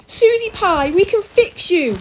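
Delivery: high, loud plea; desperate cry. A narrator's reading. Father pleads with his giant daughter, crying out. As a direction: cry out with drawn-out final words. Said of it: "Mister Prune cried".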